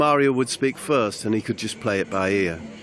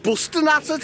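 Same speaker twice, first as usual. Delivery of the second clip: "Busting at it" repeated much higher, hammed-up Scouse; Scouse mimicry. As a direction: quote Jamie's "Busting at it" with an exaggerated Scouse lift. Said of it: "that's how he said it".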